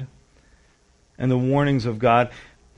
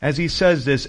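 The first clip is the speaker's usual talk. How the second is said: normal talking voice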